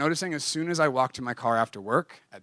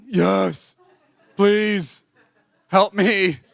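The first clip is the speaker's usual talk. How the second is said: mock desperate plea; 6 semitones higher, 9 dB louder, much slower than usual. Begging boss for help, mock.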